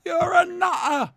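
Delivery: loud, high, drawn-out shout; mock outburst. Commentator heckling the screen in a mock outburst, shouted. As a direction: yell it slowly at the top of your voice.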